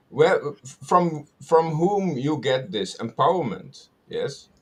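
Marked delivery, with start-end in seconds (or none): none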